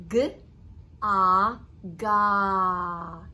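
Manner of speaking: each sound "n", "a" said separately, then blended into "nut". Shown as a demonstration. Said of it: "say the blend and then add".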